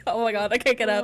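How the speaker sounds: usual speaking voice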